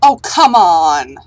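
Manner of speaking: drawn-out exasperated yell; slow, loud groan of frustration; frustrated shout. Real, not acted. Ship flies off, frustration.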